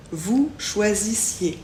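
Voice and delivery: "choisissiez" lent, finale en i-é; EZ prononcé é. Shown as a demonstration. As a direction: articuler lentement "choisissiez" jusqu'au é final. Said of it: "Le E Z se prononce et on dit donc". (« choisissiez » posé, EZ dit é, Z muet)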